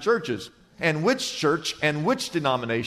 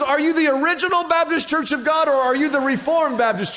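high-pitched acted question; hammed-up eager passerby; 10 semitones higher, 4 dB louder than usual. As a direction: press on excitedly for common ground.

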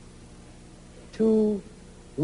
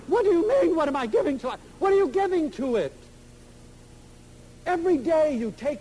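heated retold rant; 7 semitones higher than usual. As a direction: fire the questions back at her, exasperated.

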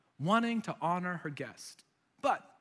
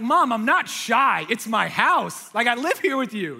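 exasperated protest; 3 semitones higher, 7 dB louder than usual. Retold or imitated. Grown child protesting to mom at home, exasperated. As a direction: push back loudly, dragging out each objection. Acted protest to his insistent mother.

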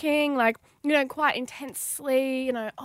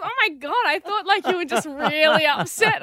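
higher, louder retelling of a laughing friend; imitating amused relief; 4 semitones higher, 5 dB louder than usual. Retelling a friend's amused reaction, imitated laughing relief. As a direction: exclaim it as the tickled friend. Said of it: "she was really laughing".

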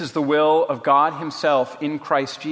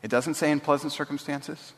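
gentle reminder; 6 dB quieter than usual. A narrator's reading. Betsy gently answers Corrie's protest.

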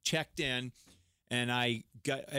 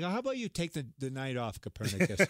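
sarcastic, higher-pitched retort; comic incredulous put-down; mock exasperation; 4 semitones higher than usual. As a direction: shoot down a stupid question for laughs. Mocks stupid eclipse question.

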